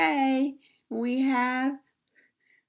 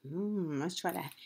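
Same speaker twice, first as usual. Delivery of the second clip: usual speaking voice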